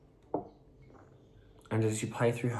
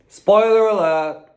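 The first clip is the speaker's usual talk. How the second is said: playful warning; 13 semitones higher, 13 dB louder than usual. Real, not acted. Mock announcement of spoiler.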